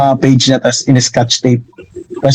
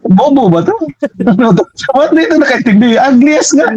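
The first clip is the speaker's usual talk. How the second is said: a high, slightly raised mock shout; retold gleeful shout; 9 semitones higher than usual. Young artist shouting to people after harsh critique, high mock yell. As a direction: replay yelling to the crowd in disbelief, half laughing. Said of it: "sumigaw ako dun sa mga tao".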